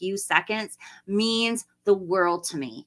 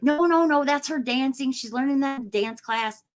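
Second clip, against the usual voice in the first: granddad defending her; 6 semitones higher than usual. Retold or imitated. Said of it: "He's like".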